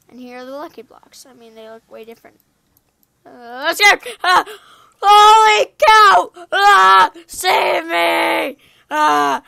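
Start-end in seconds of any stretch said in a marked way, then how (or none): 3.3-9.4 loud, high yells for help; panicked screaming. Pretend. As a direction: scream as monsters swarm you. Mobs attack him in game.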